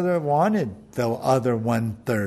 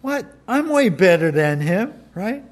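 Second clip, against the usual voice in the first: accusatory; 5 semitones higher, 4 dB louder than usual. Acted. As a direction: drag it out, pointing at the brother. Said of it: "accusatory kind of tone".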